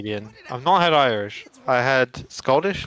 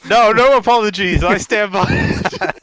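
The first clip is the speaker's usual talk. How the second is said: louder, higher mock-stubborn refusal; proud mock defiance, a bit higher; playful defiance; 8 semitones higher, 8 dB louder than usual. Real, not acted. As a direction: dig in your heels jokingly. Defiant joke about Scousers.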